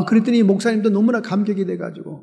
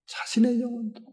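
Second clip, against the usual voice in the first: soft echo; 5 semitones higher, 11 dB quieter than usual. Acted. Preacher echoes phrase, softly.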